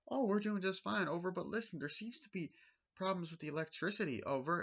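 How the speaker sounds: high, chirpy radio report; bright mock-Wendy over the radio; imitating Wendy on radio. Wendy reporting to the ranger in a high chirpy voice.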